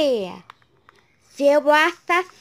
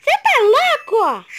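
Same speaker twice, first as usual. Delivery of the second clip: shaken and excited; 5 semitones higher than usual. Real, not acted. After the scare.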